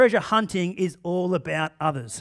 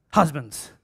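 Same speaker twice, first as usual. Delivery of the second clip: ordinary talk, nothing marked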